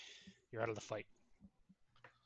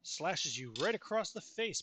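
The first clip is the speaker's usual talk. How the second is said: dramatic scene narration; 6 semitones higher, 7 dB louder, slower than usual. Acted. GM narrating the attack.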